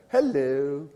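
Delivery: put-on lilting European-accented greeting; put-on European accent, gently sing-song. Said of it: "with this lilting European accent".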